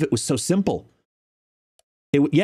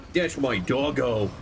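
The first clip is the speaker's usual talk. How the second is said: doting pet talk; slower than usual. Real, not acted. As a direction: draw it out lovingly to a dog. Doting on the dog.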